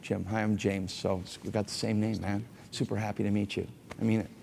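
soft, easy hello to a friend; a pastor greeting a man warmly on stage, soft and friendly. Shown as a demonstration. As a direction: greet him like you're glad he came. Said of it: "That's quite different".

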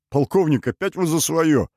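high-pitched, exasperated protest; king chiding his colonel in exasperation; exasperated exclamation. Acted.

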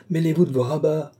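indignant cry. A narrator's reading. Housekeeper putting the abbé in his place, indignant. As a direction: tell him off for meddling. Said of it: "s'écrie la gouvernante".

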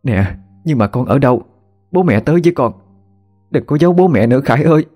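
desperate pleading. Acted. Mother begging her son to say where he is, tearful plea. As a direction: plead with him not to hide from you.

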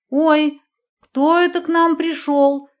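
high, cooing mock baby talk; lisping baby talk. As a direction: coo at her like a toddler. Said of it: "Нарочито сюсюкая".

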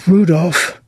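high, pointed call of a son's name; a mother about to complain; ominous mother's call. Acted. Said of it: "From the tone in which she said".

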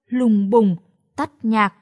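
ordinary delivery, nothing marked

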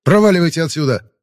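loud, high threatening yell; shouting. Acted. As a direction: drive them off with a yell. Warning armed enemies away.

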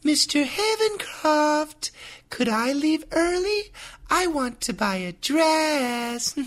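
put-on high, soft girly voice; high feminine voice. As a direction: play a sweet young woman. Dialogue woman role.

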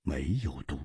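low, soft, unhurried verdict; slowly. Acted. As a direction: say it slowly and quietly. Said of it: "缓缓地说". (姬冰燕缓缓低声地说)

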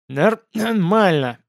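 slow, gurgling, stumbling reply. A narrator's reading. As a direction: gurgle the words out slowly. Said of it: "булькающим голосом откликнулся я".

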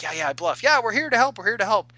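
high, eager fake reassurance; a player overselling the lie; hammed-up bluff. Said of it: "one of the guys was even playing it up".

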